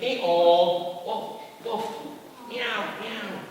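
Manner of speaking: bray, bark, then high meow; playful parade of animal calls; animal noises. Acted. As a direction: switch from donkey to dog to cat.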